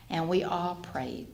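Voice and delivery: usual speaking voice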